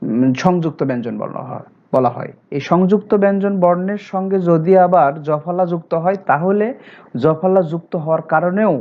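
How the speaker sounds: ordinary delivery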